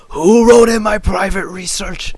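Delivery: high, loud, raspy accusation; Zane's deranged raspy shout. Acted. Zane accusing others over his research, deranged raspy shout. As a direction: yell it like a raspy madman. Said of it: "Just do a raspy voice".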